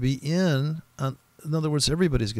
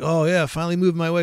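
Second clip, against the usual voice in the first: louder, higher and fast, imitating a relieved trader; mock relief; 6 semitones higher, 5 dB louder, faster than usual. Trader finally sees price move his way, hammed-up excited relief. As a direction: act out a trader's quick burst of relief. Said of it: "you're like".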